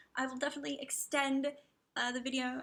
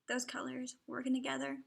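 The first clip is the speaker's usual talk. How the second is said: normal talking voice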